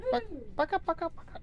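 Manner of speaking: high, soft clucking imitation; chicken clucking. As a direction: cluck back at the hen.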